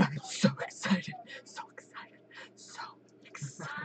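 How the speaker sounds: hushed, voiceless repetition fading away; tiny whispered excitement; excited whisper. Shown as a demonstration. Lowers volume for viewers' speakers.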